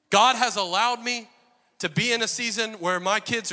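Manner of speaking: loud, high, driving sermon delivery; preaching at full force. Acted. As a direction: preach it hard to the congregation.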